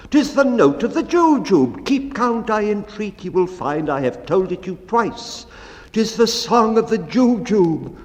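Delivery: high-pitched triumphant declaring; eager proclamation. Acted. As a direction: announce it like a proud discovery. Butcher proclaims the Jubjub's note.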